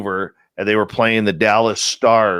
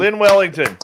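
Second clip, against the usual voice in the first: high, loud shout-out; announcing a name; 10 semitones higher, 4 dB louder than usual. Real, not acted. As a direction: call her name out like an emcee. Host repeats guest's name.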